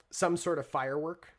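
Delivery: ordinary talk, nothing marked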